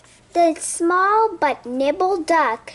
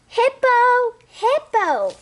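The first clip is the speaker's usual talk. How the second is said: high, louder call for a lost friend; 9 semitones higher, 4 dB louder, slower than usual. Acted. Duck searching the pond, calling out for Hippo.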